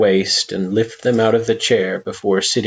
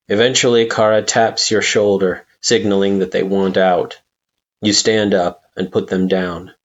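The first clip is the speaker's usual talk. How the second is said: ordinary delivery, nothing marked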